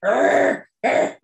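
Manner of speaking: short imitated zombie groans; zombie growl. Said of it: "noise she was making".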